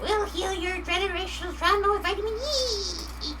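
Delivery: high, lightly voiced gremlin. Gremlins promising healing to the streamer, lightly voiced high. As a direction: offer it like a sly little gremlin. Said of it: "The gremlins can help heal".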